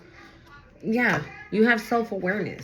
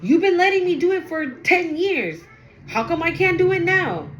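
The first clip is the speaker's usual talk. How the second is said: higher, louder, protesting like a spoiled person; higher, louder mock indignation; indignant outburst; 8 semitones higher, 3 dB louder, faster than usual. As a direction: whine it out, offended you've been cut off.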